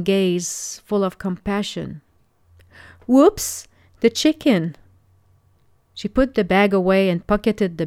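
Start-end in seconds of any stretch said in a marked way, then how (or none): 3.1-4.7 high, sudden yelp of alarm; a quick, higher-pitched oops moment; startled exclamation. Acted. Jasmine suddenly remembers the chicken on the stove, startled exclamation. Sudden realization the dinner is burning.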